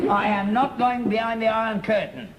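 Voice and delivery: high, adamant protest; indignant refusal. Acted. Father refuses his son's Russian holiday, indignant.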